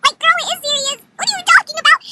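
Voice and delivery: very high, loud disbelief; incredulous retort. Retold or imitated. Acted disbelieving comeback.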